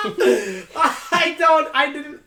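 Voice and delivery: high-pitched helpless laughing; high wheezing laugh. Real, not acted. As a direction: break into shrieking laughter. Cracking up at own story.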